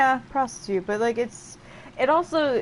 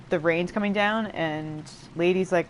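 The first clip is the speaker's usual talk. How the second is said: ordinary delivery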